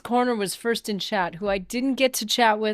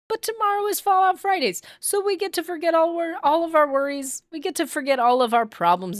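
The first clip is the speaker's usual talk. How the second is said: excited; 8 semitones higher than usual. Real, not acted. Excited about Fallout Fridays.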